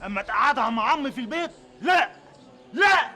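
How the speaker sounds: loud, high, flat-out no; firm refusal. Acted. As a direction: slam the door on the idea. Son refusing father loudly.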